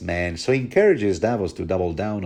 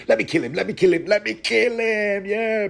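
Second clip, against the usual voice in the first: much higher, eager begging voice; frantic guard; 12 semitones higher than usual. Retold or imitated. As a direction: plead to be let loose with the dagger. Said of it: "Axel goes apeshit".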